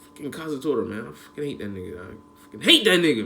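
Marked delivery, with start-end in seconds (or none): 1.1-2.2 low, soft, bitter grumble under the breath; quiet grumble. Real, not acted. Repeated hate, first low then loud. 2.2-3.2 louder and higher, spitting out real anger; angry outburst. Real, not acted. Repeated hate, first low then loud.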